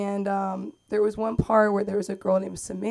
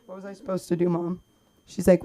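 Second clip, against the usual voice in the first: normal talking voice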